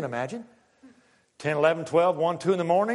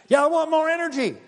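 high, loud call for more energy; energetic shout; 11 semitones higher, 5 dB louder than usual. Acted. Preacher egging on the room for more energy, high shout.

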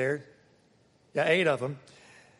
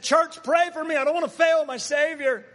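high, raised pleading voice; imagined pleading; 10 semitones higher, 5 dB louder than usual. Acted. Imagined Peter asking the church for prayer, high acted plea. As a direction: plead loudly to the congregation, pitched well up.

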